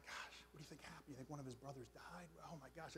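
very soft, worried barroom murmur; hushed concern. Acted. Regulars fearing a brother has died, hushed. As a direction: drop right down, as a bar gone quiet. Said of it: "the bar kinda gets quiet".

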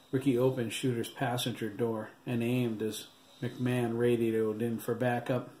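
plain reading voice

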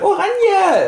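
very high loud whoop; rallying cry. Pretend. Friend rallies Carlos for the Netherlands, very high cheer. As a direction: cheer it like a football chant.

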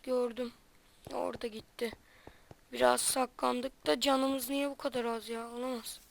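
very soft, hushed voice while hiding. Real, not acted. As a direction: keep it low so nobody hears. Hiding from enemies in game.

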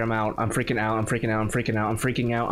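low, monotone anxious repetition; contained panic. Real, not acted. Player fearing a chase, repeating it low and anxious. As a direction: repeat it low like a nervous chant.

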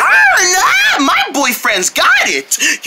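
very high squeal, slowing into words; gushing cheer. Acted. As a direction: squeal proudly for a boyfriend. Girlfriend cheers her boyfriend.

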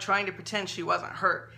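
plain reading voice

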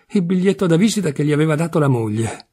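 ordinary delivery, nothing marked

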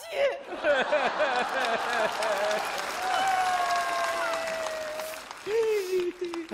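high, drawn-out cackle of a laugh; long high cackle. Acted. Father cackling high at his daughter's outburst.

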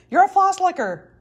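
hammed-up teacher calling her out; mock accusing yell. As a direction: pin the label on her like a verdict. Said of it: "he yelled at me".

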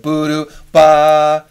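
scat building to a loud ba; sung crescendo. Shown as a demonstration. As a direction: swell the last note hard. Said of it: "a little more expressive if they crescendo".

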